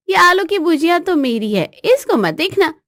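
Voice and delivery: bossy, high-pitched claim; possessive wife. Acted. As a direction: warn him off your snack. Wife claims her snack.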